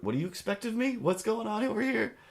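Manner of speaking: high, incredulous disbelief; baffled. Real, not acted. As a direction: voice shoots up in shock. Baffled reaction to the ad.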